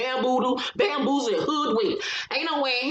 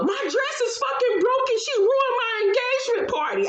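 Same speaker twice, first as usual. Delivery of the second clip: much higher, wailing complaint; upset shout; 13 semitones higher than usual. Retold or imitated. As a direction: cry out that the party is ruined. Egypt upset after the fight, retold.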